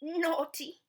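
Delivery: put-on high, loud little baby voice; Baby Blair. Baby Blair reporting the bowl is empty, high baby voice. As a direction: chirp it out. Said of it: "said Baby Blair".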